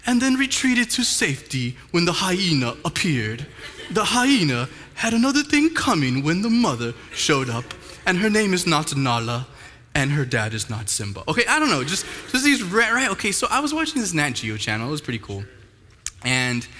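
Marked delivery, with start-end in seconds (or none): 0.1-11.2 mock British wildlife narrator; imitated British accent. Preacher spoofing a lion cub documentary in a mock British accent. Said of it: "people with British accents".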